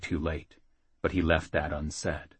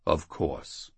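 solemn; 3 semitones lower, 3 dB quieter than usual. Acted. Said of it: "the priest promised solemnly".

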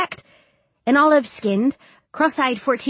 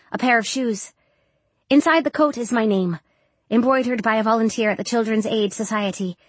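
usual speaking voice

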